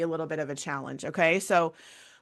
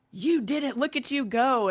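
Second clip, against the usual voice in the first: mock cheerleader praise; 6 semitones higher than usual.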